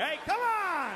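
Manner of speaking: high, strained protest; exasperated yell. Acted. Man interrupted at breakfast by two guys, exasperated yell. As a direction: yell it as an outraged, hammed-up objection.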